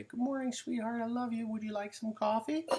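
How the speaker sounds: high, sugary mock-tender voice; husband waking wife with coffee, high sugary coo; sweet morning voice. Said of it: "and say".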